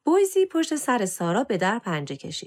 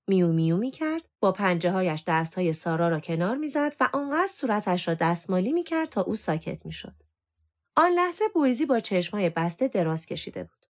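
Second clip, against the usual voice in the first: plain reading voice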